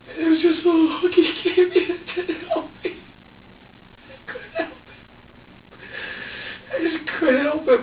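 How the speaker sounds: high, broken tearful retelling; real distress, voice climbing high and breaking. Real, not acted. Telling how he looked for help, tearful and high.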